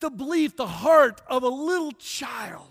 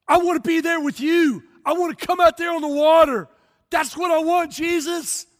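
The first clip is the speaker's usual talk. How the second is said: eager plea; 6 semitones higher, 6 dB louder than usual. Retold or imitated. Peter pleading to Jesus.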